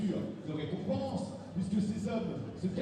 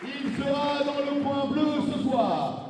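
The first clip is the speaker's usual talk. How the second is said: announcer intro; 6 semitones higher, 4 dB louder, slower than usual. Real, not acted. Announcer keeps fighter intro going.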